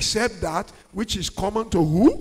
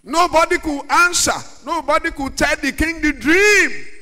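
loud, higher storytelling; fiery preaching; 5 semitones higher, 7 dB louder than usual. Acted. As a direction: preach the tale with fire.

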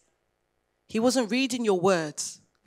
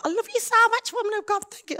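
a much higher, gushing imitation of the grateful friend; faster than usual. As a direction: squeal it like an overjoyed friend reading her thanks. Reading friend's grateful text.

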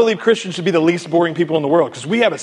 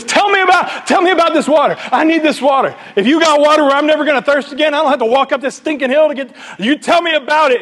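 high, urgent acted pleading; hammed-up desperate insistence, pitched way up; eager demand; 10 semitones higher, 3 dB louder than usual. Samaritan woman begging Jesus for this water, urgently demanding.

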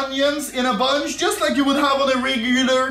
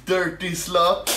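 usual speaking voice

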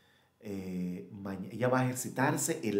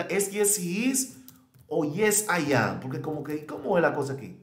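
high, loud, puzzled student voice; confused student; 7 semitones higher, 7 dB louder than usual. Retold or imitated. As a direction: play the confused student asking teacher. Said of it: "Yo le voy a preguntar al teacher". (estudiante confundida que pregunta a la maestra, voz alta)

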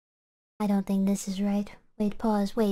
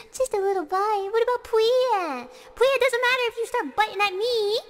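giddy high voice; 10 semitones higher than usual. Acted. Shark girl wanting just a little bite, giddy high squeal.

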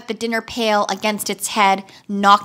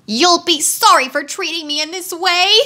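higher whiny threat; whining wheeler; 7 semitones higher than usual. A narrator's reading. Wheeler threatens Tick-Tock, whining higher. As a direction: whine it like a sulky bully. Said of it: "whined the wheeler".

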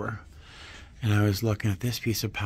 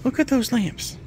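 higher, bubbling excitement over lamps; excited; 11 semitones higher than usual. Real, not acted.

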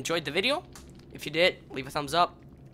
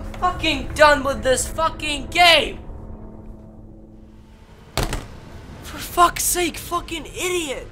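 higher and louder angry yell; furious outburst; 12 semitones higher, 5 dB louder than usual. Real, not acted. Gamer quitting in fury at his teammate, angry yell. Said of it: "for screaming and yelling".

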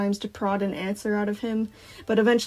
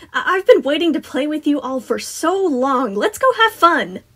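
Sun, gushing excitement; 7 semitones higher, 8 dB louder than usual. A narrator's reading. Sun urging children to play, gushing excitement. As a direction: build to a big rallying call.

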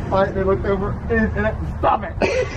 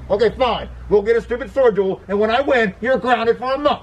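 raised-pitch, grudging threat; exasperated dad; 6 semitones higher than usual. Acted. Dad accepts the stupid duel, higher and exasperated. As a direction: give in crossly, then threaten.

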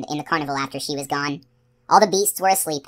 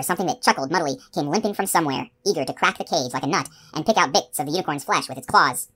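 ordinary delivery, nothing marked

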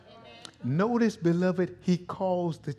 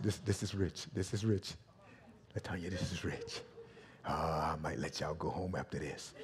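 low, very soft savoring; soft, low relish; hushed aside; 10 semitones lower, 13 dB quieter than usual. Real, not acted. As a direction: drop right down as if savoring a secret. Relishing the passage, aside to congregation.

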